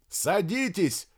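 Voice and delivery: high, drawn-out call; shouting. A narrator's reading. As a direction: shout the order slowly over a distance. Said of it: "крикнул ему Сергей Макарович". (крикнуть протяжно)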